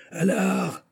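high, held-out chanted call; crowd cry. A narrator's reading. The great mass of the crowd cries together, crowd cry. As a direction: call it out high as a whole mob at once. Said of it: "cria le grand ensemble".

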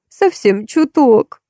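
mock sniffle. A narrator's reading. Old beggar woman sniffling to Marta over her list, slow and pitiful. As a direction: play the poor little granny, sniffling. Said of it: "всхлипнула бабуля".

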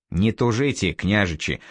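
ordinary delivery

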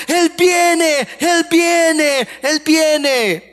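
put-on high, joyful chant of children; children shouting joy. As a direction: cry it out like excited children. (niños gritando con júbilo, voz muy aguda)

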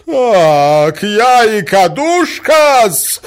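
high, loud, drawn-out squeal; indignant shriek. A narrator's reading. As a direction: shriek it, mortally offended. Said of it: "взвизгнула Наталья Дмитриевна". (взвизгнуть возмущённо)

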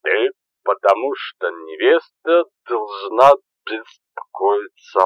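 slurred, slow tipsy babble; drunk rambling. Acted. Заплетающимся языком.

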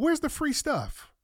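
"Where's the free stuff?" asked high and slowly, stretched in disbelief; high incredulous. Real, not acted. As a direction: squeak it out, stunned. Repeats question, high then low.

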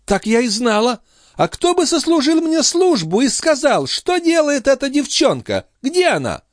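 high-pitched lady's voice, complaining about her brother; indignant countess. Acted. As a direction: play a high, exasperated countess.

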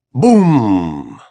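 drawn-out falling "boom"; loud-onset explosion noise; imitated explosion boom. A narrator's reading. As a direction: boom out the blast and let it fall. Reader voices the explosion.

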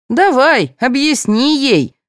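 high, drawn-out taunt; smirking. A narrator's reading. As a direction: goad her with a smirk, slowly. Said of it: "ухмыльнулась Галина".

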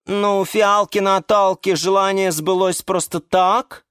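a mild indignant orchid; touch of outrage from the orchid. A narrator's reading. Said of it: "возмутилась".

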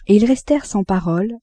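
ordinary delivery

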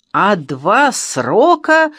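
loud, slow, emphatic retort; blurted out. A narrator's reading. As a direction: hurl it out as an accusation. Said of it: "выпалил кто-то из ребят".

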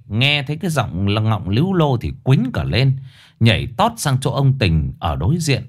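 ordinary delivery, nothing marked

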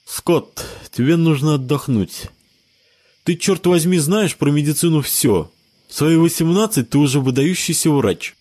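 talking around a cigarette in teeth; cigarette between teeth. Acted. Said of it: "говорил, зажав сигарету зубами".

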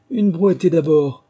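loud, slow call; rabbit's order. A narrator's reading. The rabbit ordering a barrow load, loud and slow. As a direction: order it out slowly. Le lapin qui disait.

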